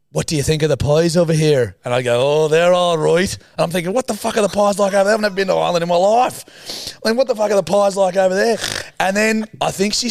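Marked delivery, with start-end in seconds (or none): 0.1-1.8 higher, louder Irish lady; imitated Irish woman. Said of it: "she'd go". 2.4-3.3 high, loud mock Irish reply; put-on Irish accent. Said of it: "I had to go along with it". 3.9-8.6 high, loud, fast outburst; retold panicked thought.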